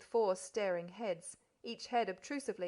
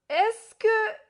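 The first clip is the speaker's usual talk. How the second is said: much higher and louder sudden outburst; raised voice; 13 semitones higher, 12 dB louder than usual. A narrator's reading. Spectacled gentleman bursting into French, loud and high. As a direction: blurt it out loud. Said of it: "saying in a raised voice".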